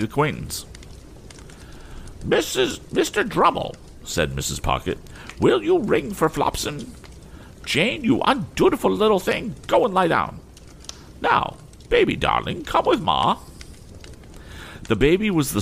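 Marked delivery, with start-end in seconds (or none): 2.2-3.8 very high, imperious scolding lady; haughty mother scolding. A narrator's reading. Mrs Pocket voiced in story. 5.4-13.4 very high, imperious scolding lady; haughty mother scolding. A narrator's reading. Mrs Pocket voiced in story.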